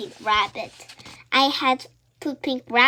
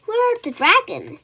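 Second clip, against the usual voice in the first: eager; 10 semitones higher, 4 dB louder than usual. Acted. Eager question in morning.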